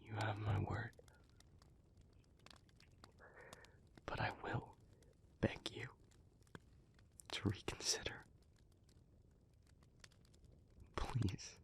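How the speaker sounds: very soft, near-whispered resignation; subdued pleading. Acted. Speaker promising not to stop the listener, hushed resigned plea. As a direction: yield quietly, with long heavy pauses. Gives in, begs to reconsider.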